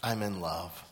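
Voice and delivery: low, soft, dreamy confession; soft lovesick murmur, acted; lovestruck. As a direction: pretend to be blissfully in love. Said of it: "He's really happy".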